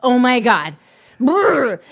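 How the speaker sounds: loud disgusted Oh my God; disgusted groan. Real, not acted. Disgust at ASMR mention.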